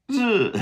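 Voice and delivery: "自" as very high, loud dz buzz; zi sound demo. Shown as a demonstration. Teacher demonstrating D to Z, high buzzed "自". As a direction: start "自" on D, slide straight into Z, falling. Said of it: "go straight to the Z".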